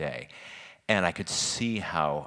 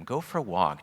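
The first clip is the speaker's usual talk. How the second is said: normal talking voice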